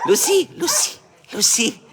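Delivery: sweet high call to a pet. Real, not acted. Calling dog in high voice.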